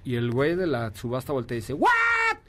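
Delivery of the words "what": high shocked "What?"; surprised shout. Retold or imitated. Auctioneer reacts in surprise.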